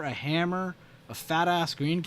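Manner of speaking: ordinary talk, nothing marked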